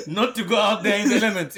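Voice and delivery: words bubbling with laughter; laughing. Real, not acted. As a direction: still laughing at her own joke. Laughing after the snow joke.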